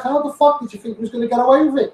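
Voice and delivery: ordinary talk, nothing marked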